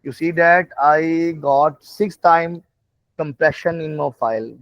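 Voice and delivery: ordinary delivery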